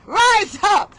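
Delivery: ordinary talk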